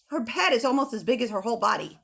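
usual speaking voice

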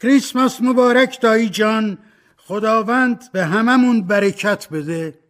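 high, loud, joyful holiday greeting; a young nephew bursting in with a loud merry hello; loud cheerful greeting. A narrator's reading. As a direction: burst in with big happy cheer. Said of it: "صدای شاد و بلندی".

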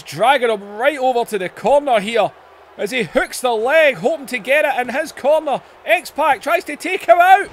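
high, loud, building to the win; excited victory call. Real, not acted. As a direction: announce the winner with big hype. Match-winning pin called.

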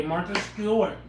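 lightly put-on host voice, falling; Martha Stewart voice. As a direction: say the name like a TV host. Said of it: "My name's Martha today".

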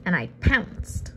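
usual speaking voice

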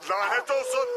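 loud, high outburst; anguished shouted curse. Acted. Father in a hostage standoff, anguished shouted curse. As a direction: yell the curse in helpless fury.

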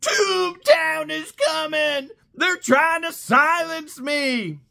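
high, crying mock wail of a desperate defendant; mocking whiny plea. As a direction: whine it like a man begging the judge. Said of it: "John Crook says".

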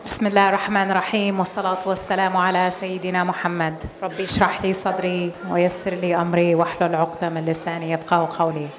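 rapid, low murmured Arabic invocation; fast devotional recitation before a speech; quick opening prayer. Real, not acted. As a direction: rattle off the opening dua quickly and devoutly.

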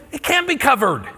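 loud, high disbelief; disbelieving protest. Retold or imitated. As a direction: push back in shock. Disbelief at tuition covered.

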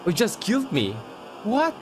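high-pitched, incredulous outburst; stunned disbelief. Real, not acted. Player just killed by the game, stunned. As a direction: squeak out your disbelief.

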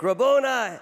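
high, loud cry of recognition; a joyful shout of recognition; cried out. Acted. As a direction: call out Rabboni in sudden joy. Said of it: "cried out in Aramaic".

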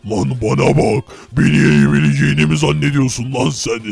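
low, taunting tough-guy growl; menacing deep voice. Acted. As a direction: lean in low and threatening. Guard taunts fighter before match.

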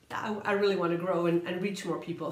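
ordinary talk

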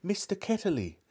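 high shout of sudden recognition. A narrator's reading. Girl suddenly remembering calls the name out. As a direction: blurt the name as it comes back. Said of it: "she shouted out".

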